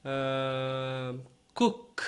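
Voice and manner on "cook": "cook" with the double O said as a short U, like "kuk"; OO sounds like U. Shown as a demonstration. Teacher showing students the OO sound in "cook". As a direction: give "cook" an U sound, not O. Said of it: "dois O junto, vai dar um som de U".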